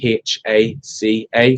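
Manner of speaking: slow, careful letter by letter; spelled out slowly. Shown as a demonstration. Chef spelling his restaurant name for the host, slowly. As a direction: space out every letter. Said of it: "spells out the restaurant name slowly".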